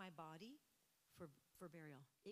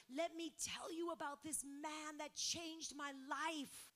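louder, slower, exultant 'Let me tell you'; rising joyful testimony; 8 semitones higher, 5 dB louder, slower than usual. Retold or imitated. Samaritan woman telling the town about Jesus, louder and slow. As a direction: proclaim it like the news of your life.

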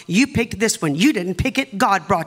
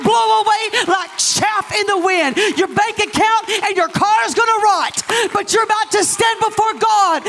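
high, ringing preacher's shout; full-force preaching; 11 semitones higher, 3 dB louder, slower than usual. Real, not acted. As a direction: cry it out to the whole church at a climax.

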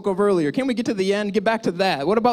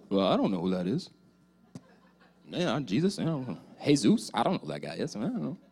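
lower, softer mumbled denial; nervous denial; 7 semitones lower, 6 dB quieter than usual. Acted. Peter denies knowing Jesus, low and evasive. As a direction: shrink away from the question.

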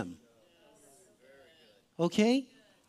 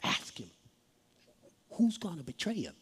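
hushed, secretive request; low, soft conspiratorial voice; furtive aside; 4 semitones lower, 9 dB quieter than usual. Acted. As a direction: murmur it on the sly to the one beside you. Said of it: "he goes".

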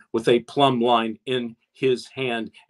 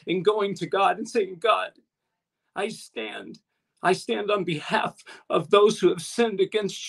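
higher-pitched, emphatic preacher; impassioned preaching; 5 semitones higher than usual. Real, not acted. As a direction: preach it with raised pitch, driving the point home.

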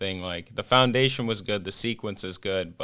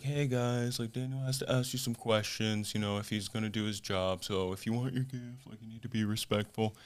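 much softer imitated desk voice; gentle retold admonishment; 7 dB quieter than usual. Tour desk asking guests to be respectful, quiet imitated voice.

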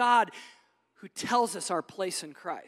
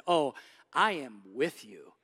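gentle voice of God; 7 semitones lower than usual. Acted. Preacher voicing God's reply gently, lower and slow. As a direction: lower and slow, a calm reassurance.

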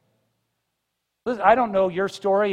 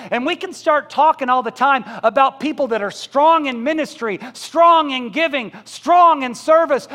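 louder and higher, slowing to hammer each phrase; demanding church prayer; 5 semitones higher, 7 dB louder than usual. Acted. Pastor mimics a needy church, loud and pressing. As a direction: preach it like a church begging God for strong people.

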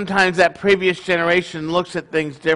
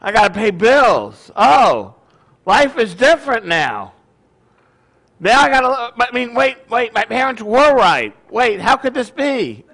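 loud, higher-pitched mock dawning shock; sudden realization; 4 semitones higher, 5 dB louder than usual. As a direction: act out a young adult's startled realization. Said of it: "and they realize".

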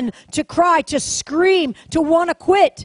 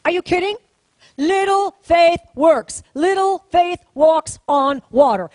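usual speaking voice

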